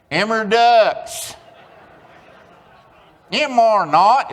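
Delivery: high, slow, loud drawled twang; exaggerated Oklahoma twang; Oklahoma twang reading. Shown as a demonstration. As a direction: sound it out like a country reader. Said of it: "read that aloud with me".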